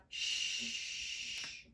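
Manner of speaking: "Shh" as a soft, long unvoiced hiss; digraph sound. Shown as a demonstration. Teacher asking about spelling, "Shh" held long and soft. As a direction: stretch "Shh" without any vowel. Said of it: "How do I spell the digraph".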